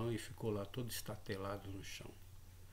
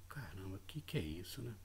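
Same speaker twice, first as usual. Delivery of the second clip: hushed aside; 2 semitones lower, 6 dB quieter than usual. Retold or imitated. Thinking to himself, startled.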